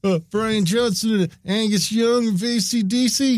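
high, breathless fanboy; starstruck fanboy voice. Retold or imitated. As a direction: gush like a Wayne's World fan. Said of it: "It's like Wayne's World".

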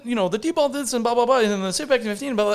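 high, fast rattled-off explanation; rapid rundown. Retold or imitated. Explaining devices to Mo, rattled off fast and high. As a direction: rush through the specs. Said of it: "then I'm like".

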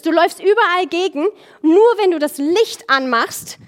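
lauter, höherer Predigtton mit Nachdruck; eindringliche Predigt. Acted. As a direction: der Gemeinde die Freiheit zusprechen. Predigt mit voller Kraft. (kraftvoll predigen)

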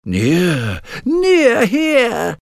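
very high, slow cackling witch. Acted. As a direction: drag it out like an old hag. Witches voiced.

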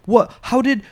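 high, fast baffled protest; baffled outrage. Retold or imitated. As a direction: squeak it out in disbelief. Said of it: "you're like".

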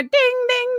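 high sing-song ding ding like a boxing bell; mock ring announcer ringing the bell; bell imitation.

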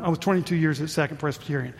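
usual speaking voice

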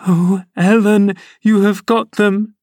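put-on high, pleading girlish cry; a frightened girl begging for her letters; desperate girl. A narrator's reading. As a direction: plead as if caught out. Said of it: "she commenced immediately".

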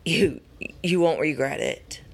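ordinary delivery

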